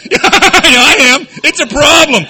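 high, loud, drawn-out exclamation; loud mock outburst. Speaker answering his own question, loudly exasperated. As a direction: burst out at the top of the voice.

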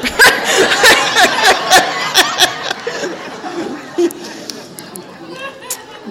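long breathless wheezing laugh. Real, not acted. Speaker cracking up at herself, long breathless laugh. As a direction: break up at your own joke. Laughing at her own joke.

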